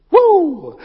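a high, loud celebratory whoop; whoop of joy. Real, not acted. Preacher cheering after rebuking the devil, loud whoop. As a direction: cheer the win over the lie.

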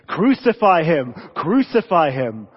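slow, loud chant of a mob; loud, drawn-out mob cry; crowd shouting. Retold or imitated. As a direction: call it out like the angry crowd. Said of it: "have been shouting".

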